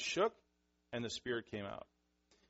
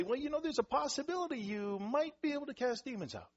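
very high, wishy-washy voice; mock tentative; 12 semitones higher than usual. Shown as a demonstration. Preacher mocking what Jesus didn't say, high and tentative. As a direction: hedge it in an exaggerated high pitch. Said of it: "He didn't say".

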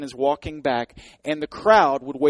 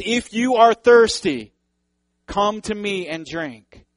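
high, loud and slow call; Jesus calling out to the festival crowd; loud proclamation; 6 semitones higher, 6 dB louder, slower than usual. Retold or imitated. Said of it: "Jesus stands up and says".

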